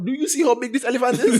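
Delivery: high, loud, incredulous boast; hammed-up excitement with a laugh; acted amazement. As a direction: cut in, marvelling at the size. Rider cuts off a doubter.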